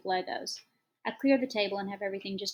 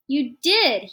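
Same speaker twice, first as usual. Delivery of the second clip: put-on much louder, higher kid's yell; child yelling; 6 semitones higher, 13 dB louder than usual. A narrator's reading. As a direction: yell it back at mom. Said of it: "he yells".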